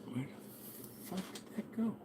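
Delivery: voiceless, drawn-out whisper; whispered searching. Real, not acted. Streamer hunting for lost thing, whispering to self. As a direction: whisper it slowly to yourself.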